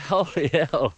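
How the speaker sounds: laughing in disbelief. Real, not acted. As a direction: let it out through a laugh. Laughing after the kill.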